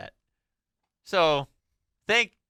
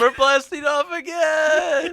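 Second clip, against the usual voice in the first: high, slow Team Rocket shout; 12 semitones higher, 9 dB louder, slower than usual. Acted. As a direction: yell it like Team Rocket flying away. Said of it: "like Pokemon?".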